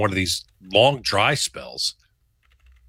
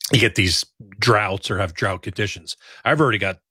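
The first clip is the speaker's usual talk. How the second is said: ordinary delivery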